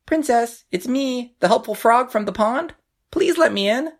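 high, small frog voice; squeaky storybook frog; little frog voice. A narrator's reading. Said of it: "a little voice cry out".

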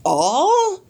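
high, astonished "All?"; a stunned high question; gasped. A narrator's reading. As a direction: gasp it in disbelief. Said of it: "gasped Mister Watson".

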